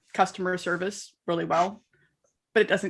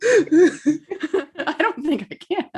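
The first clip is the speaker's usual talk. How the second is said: laughing; 7 semitones higher, 3 dB louder, much slower than usual. Real, not acted. Laughing refusal.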